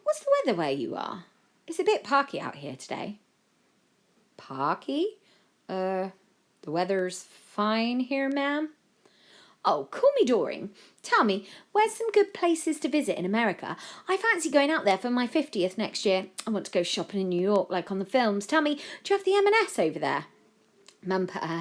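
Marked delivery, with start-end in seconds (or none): 0.0-3.2 higher, chatty mother; Joe's mum. A narrator's reading. Mum asks the President about weather, chatty. 4.4-8.7 hesitant, higher, baffled American; puzzled US President. A narrator's reading. The President puzzles over mum's slang, baffled. 9.6-20.3 higher, gushing chatterbox; gossipy mum Doreen. A narrator's reading. Mum quizzes the President on America, gushing.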